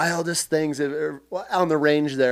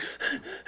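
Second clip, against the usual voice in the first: sharp gasp; 14 dB quieter than usual. Retold or imitated. Reacting on skidding in.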